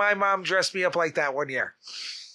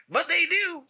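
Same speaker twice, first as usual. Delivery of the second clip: high-pitched Southern twang; Southern accent; 11 semitones higher than usual. Acted. Insisting hobos talk that way, high Southern accent. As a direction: slip back into the high Southern hobo voice. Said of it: "I don't know why they have Southern accents".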